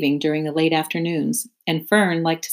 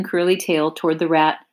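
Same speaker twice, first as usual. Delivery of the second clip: usual speaking voice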